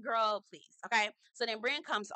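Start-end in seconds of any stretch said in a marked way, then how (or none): none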